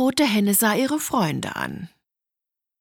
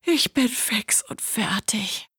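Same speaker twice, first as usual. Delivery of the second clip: exhausted, voice fading into breath; worn-out yawning; 3 dB quieter than usual. A narrator's reading. Hen tells friends she's exhausted, yawning. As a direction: sigh it out, utterly tired. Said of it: "gähnte die kleine rote Henne".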